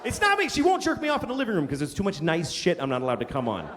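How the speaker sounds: usual speaking voice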